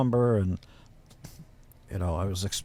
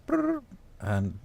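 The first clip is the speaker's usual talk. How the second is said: high buzzing brrr like a phone ringing; imitated phone ring; 13 semitones higher than usual.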